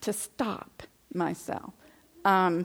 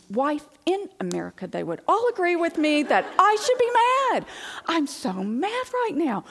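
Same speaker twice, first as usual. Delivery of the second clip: angry rant; 9 semitones higher, 5 dB louder than usual. Retold or imitated. Wife ranting to the Lord on the exercise machine, high and loud. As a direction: vent like a wife sure she is right. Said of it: "I was so frustrated with him".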